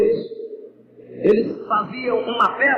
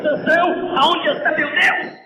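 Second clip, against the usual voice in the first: mocking Philistines; 7 semitones higher than usual. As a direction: jeer at the blind captive. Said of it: "E eles diziam".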